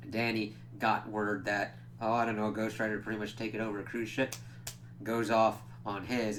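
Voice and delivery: ordinary talk, nothing marked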